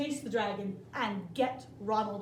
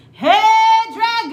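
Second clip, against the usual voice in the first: very loud, higher yell; loud shout; 11 semitones higher, 21 dB louder than usual. A narrator's reading. Princess shouting into the dragon's ear, very loud. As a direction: yell right into his ear. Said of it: "she shouted as loud as she could".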